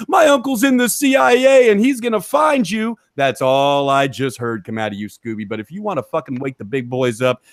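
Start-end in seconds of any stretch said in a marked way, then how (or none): 0.1-3.0 high, loud mocking kid's whine; whiny kid voice. Mocking a rival as a bratty kid, high loud whine. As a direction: sneer it like a bratty little kid threatening. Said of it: "every other fucking little kid who goes".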